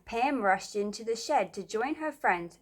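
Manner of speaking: usual speaking voice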